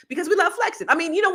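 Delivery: regaining his place, chuckling; laughing. Real, not acted. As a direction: laugh off the slip. Laughs after losing his place.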